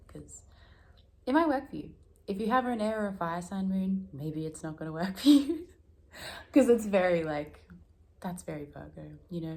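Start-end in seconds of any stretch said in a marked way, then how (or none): none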